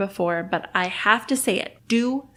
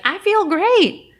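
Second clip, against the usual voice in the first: much higher, louder and slow, gushing; mock enthusiasm; 11 semitones higher, 7 dB louder, slower than usual. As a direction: ham up feeling great. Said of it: "after you're like".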